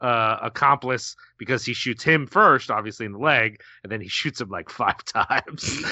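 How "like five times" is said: laughing. Real, not acted.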